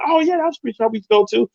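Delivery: high, eager reminiscing; light up at the memory; excited recognition. Retold or imitated. Spin recognizing her old pizza shop, high and eager.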